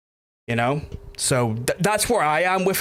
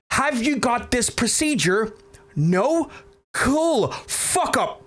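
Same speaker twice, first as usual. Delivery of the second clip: higher-pitched mock rant at a know-it-all relative; angry telling-off; 8 semitones higher than usual. Retelling telling off relatives who scare mum, higher angry rant. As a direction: tear into them, half acting it out. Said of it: "Me shouting at people".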